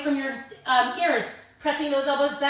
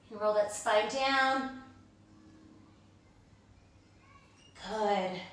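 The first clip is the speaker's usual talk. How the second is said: calm stretch cueing; 6 dB quieter than usual. Acted. Slow stretching cues.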